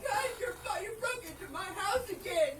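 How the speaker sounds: high, teary half-laugh through sobs; laugh-crying. Real, not acted. Woman tells officer he broke in, laugh-crying. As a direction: choke it out between sobs and laughs.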